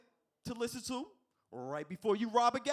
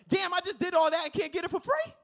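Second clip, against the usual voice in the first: much higher, louder outraged shout; 12 semitones higher, 10 dB louder than usual. Retold or imitated. [name] outraged at the drive-thru total, shouting loud. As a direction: yell it in disbelief. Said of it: "do this impression".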